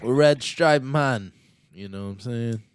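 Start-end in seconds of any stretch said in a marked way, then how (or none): 0.0-1.3 slow, loud bad Jamaican accent; terrible Jamaican accent. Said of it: "That was a terrible Jamaican accent".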